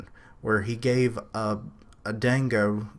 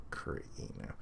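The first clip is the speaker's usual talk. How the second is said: whispered aside; 16 dB quieter, faster than usual. Real, not acted. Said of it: "name tried again under breath".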